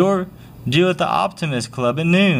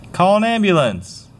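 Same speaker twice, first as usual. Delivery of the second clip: urgent call; 7 semitones higher than usual. Acted. Bystander finds drowning victim.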